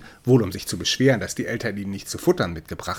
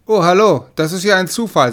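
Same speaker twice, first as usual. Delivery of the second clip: mock surprise; 7 semitones higher, 9 dB louder than usual. Cat greets Death, feigning surprise.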